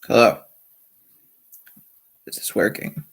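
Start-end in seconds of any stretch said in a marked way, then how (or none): 2.3-3.0 quiet, low-key mic check; leise Testfrage. Real, not acted. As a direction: ask it softly, half to yourself. Muttered check of the live stream.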